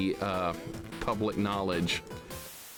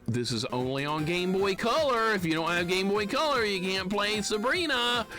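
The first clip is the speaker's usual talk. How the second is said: mock threatening; 6 semitones higher than usual. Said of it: "it gives off threatening vibes".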